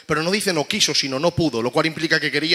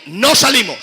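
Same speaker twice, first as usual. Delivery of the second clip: defiant demon; 7 semitones higher, 7 dB louder, slower than usual. Acted. Demon answers through the child.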